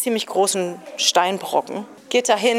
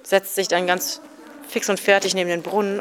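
normal talking voice

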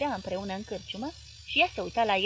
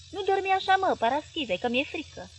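higher, mild frightened wail; whimpering plea; 6 semitones higher, 3 dB louder than usual. Acted. Wife waking her husband at night, mildly frightened. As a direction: wake him, a little scared. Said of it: "se văită ea".

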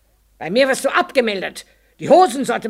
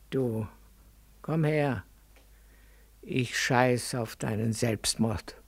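low and hushed, slow and close; quiet, into the ear; 9 semitones lower, 10 dB quieter, slower than usual. Acted. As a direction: lean in and say it softly, for his ear only. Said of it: "ganz leise, ins Ohr".

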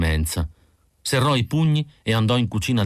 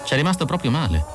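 higher, quicker nervous irritation; irritated; 7 semitones higher, faster than usual. Acted. Irmgard to Pris and Roy, irritated. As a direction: say it edgy and fast. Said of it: "disse Irmgard innervosita".

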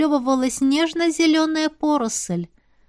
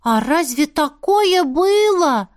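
put-on amazed child; 5 semitones higher, 3 dB louder, slower than usual. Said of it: "изумился Дэйм".

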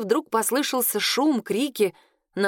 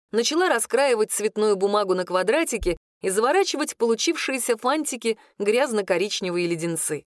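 ordinary delivery

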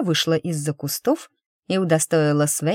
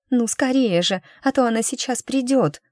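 much higher, hurried urging; urgent plea; 7 semitones higher than usual. Acted. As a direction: press him before she comes. Milasha urges in panic. (торопливо, с тревогой, высоко)

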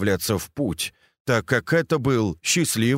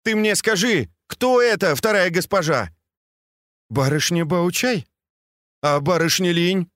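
взволнованный юноша; 7 semitones higher than usual. Acted. Бао Юй требует ответа.

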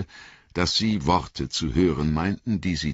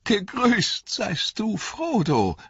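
much higher, slow, bright elvish call; elf calling out; 3 dB louder, slower than usual. A narrator's reading. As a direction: hail a friend from the road, ringing high. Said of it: "rief er".

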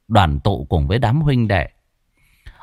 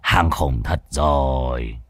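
put-on old sorcerer voice; 9 semitones lower, 4 dB quieter than usual. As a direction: drag it out low like a wary old master. Old Muôn Phọt speaks.

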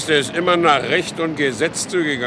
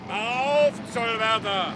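much higher call to the gate through the storm; 7 semitones higher, 4 dB quieter, slower than usual. Acted. Kohlhaas calls the tollkeeper to open, raised call. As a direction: hail the tollkeeper from outside. Calling out at night to the gatekeeper.

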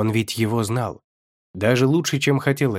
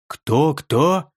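narrator's sharp, surprised double question; incredulous re-asking; 3 semitones higher, 3 dB louder than usual. A narrator's reading. Ху Вэй asks his companion to repeat, intrigued. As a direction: ask it back with sudden interest. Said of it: "переспросил Ху Вэй".

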